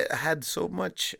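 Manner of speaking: slow, high put-on Polish accent; drawn-out mock Polish accent. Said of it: "speak in a Polish accent".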